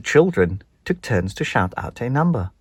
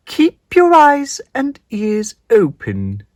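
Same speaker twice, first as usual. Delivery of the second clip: high, slow, grand godmother voice; high spider godmother; 14 semitones higher, 8 dB louder, slower than usual. Acted. Hairy godmother introducing herself to Spinderella, slow and grand. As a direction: announce yourself slowly like a magical godmother.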